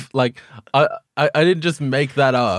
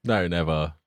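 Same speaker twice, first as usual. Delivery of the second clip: ordinary talk, nothing marked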